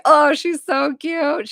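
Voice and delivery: high, loud, slow gushing over someone adorable; gushing aww. Retold or imitated. Medium gushing over a client's late grandma, high drawn-out aww. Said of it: "And I'm like".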